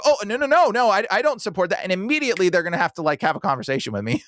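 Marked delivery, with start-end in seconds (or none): 0.0-1.8 high, stumbling hasty denial; mock flustered denial. Cornered stranger denying support for abusers, mock flustered denial. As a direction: imitate someone backpedalling in a higher voice.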